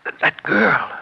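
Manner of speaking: stammering frightened whisper; panicked accomplice whispering; scared whisper. Acted. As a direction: choke out the warning in fear. Thief spots the stenographer.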